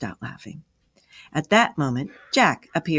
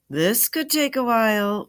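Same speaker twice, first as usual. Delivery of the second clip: wry warning; 7 semitones higher than usual. A narrator's reading. Jack warns the children about a long explanation, warning. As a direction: warn playfully, starting high and sliding down. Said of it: "warned Jack".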